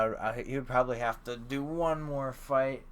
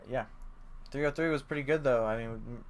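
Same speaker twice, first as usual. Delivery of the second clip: usual speaking voice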